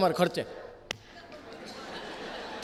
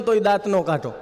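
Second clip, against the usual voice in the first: ordinary talk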